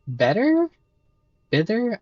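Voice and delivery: sounding out better and Bitter as questions; puzzled rereading. Real, not acted. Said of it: "I'm not sure what that's supposed to mean".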